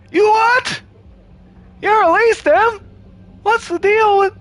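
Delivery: a failed Seinfeld impression; Jerry Seinfeld. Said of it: "I have no Jerry Seinfeld".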